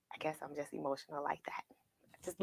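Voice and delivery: quick, breathless whisper; hushed, rapid aside to viewers. Real, not acted. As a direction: whisper it fast, like a shy confession. Confessing she is emotional.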